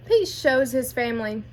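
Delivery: plain reading voice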